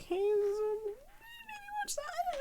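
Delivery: high, soft and very slow, barely daring; tiny timid voice. Retold or imitated. Shyly nudging viewers toward her video, tiny and hesitant. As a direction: murmur it like you hope nobody notices.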